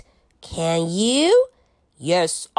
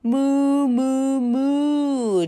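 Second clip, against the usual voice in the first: 1 mooing like a cow; 3 semitones higher, much slower than usual. Pretend. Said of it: "就是他会学着牛一样".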